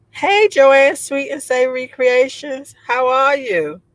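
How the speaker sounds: loud, high happy hello; excited greeting. Real, not acted. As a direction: call out to a friend in the chat. Greeting viewer by name.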